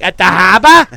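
loud, bright Boston "At the harbor?"; Boston accent. Retold or imitated. Ad woman mocked in a loud Boston accent. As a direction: toss it out in a thick Boston accent. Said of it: "talking in Boston accent".

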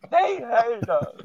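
high, slow wailing; mock wail. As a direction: cry it out high and drawn.

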